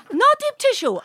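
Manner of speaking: high-pitched imitation of the masseuse. Masseuse reassuring sore client, high mimicked voice. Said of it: "And she goes".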